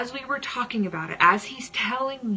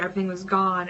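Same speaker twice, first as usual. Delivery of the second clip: usual speaking voice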